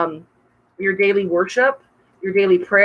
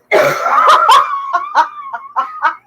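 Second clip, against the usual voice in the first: high, loud burst of laughter; big laugh; 4 dB louder than usual. Real, not acted. Laughing at the joke.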